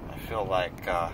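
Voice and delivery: numb mouth, slightly slurred; talking with a numb mouth; numb from the dentist. Real, not acted. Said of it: "this whole side of my mouth is numb".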